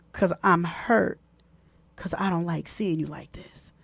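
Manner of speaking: soft, whispered hurt reply. Retold or imitated. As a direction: whisper it like someone in tears. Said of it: "voicing the crying partner".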